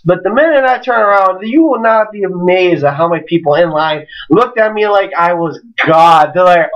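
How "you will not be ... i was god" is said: normal talking voice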